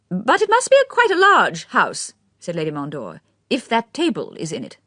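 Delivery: put-on high, fluttery elderly aristocrat; old lady's voice. A narrator's reading. Lady Mondor remarks.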